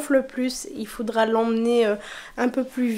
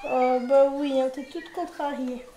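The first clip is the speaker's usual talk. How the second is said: tender cooing; 7 dB quieter than usual. Real, not acted. Comforting upset cat tenderly.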